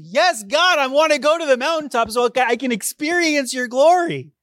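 loud, high, thrilled cry; an overjoyed believer shouting his answer to God; eager yes to God. Pretend. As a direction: leap at the invitation.

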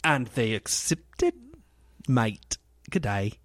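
halting attempt at an Australian accent; half-hearted Australian, word by word; bad Australian accent. Shown as a demonstration. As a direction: fumble through a weak Aussie accent. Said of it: "I can't do an Australian accent".

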